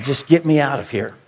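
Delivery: ordinary delivery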